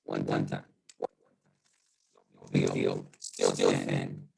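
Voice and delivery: muffled, distorted Zoom audio, low and dull; muffled mic. Real, not acted. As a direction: sound as if through a broken microphone. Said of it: "I'm coming in muffled".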